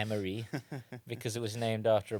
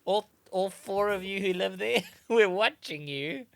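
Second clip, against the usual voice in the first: mock calling out; 8 semitones higher, 5 dB louder than usual. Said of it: "calling out to residents".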